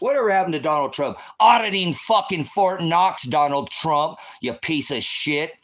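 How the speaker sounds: real angry yell of betrayal; furious shout. Real, not acted. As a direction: scream it out at him, fury boiling over. Angry rant at Donald Trump.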